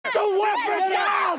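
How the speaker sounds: real, forceful shout to disarm; urgent shouted order. Real, not acted. Ordering someone to drop a weapon, urgent shout.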